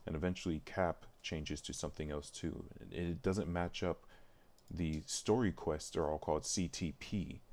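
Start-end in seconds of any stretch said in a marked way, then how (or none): none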